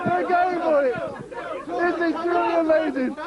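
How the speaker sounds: excited commentary. Acted. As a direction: call the contest like a live match. Live play-by-play commentary.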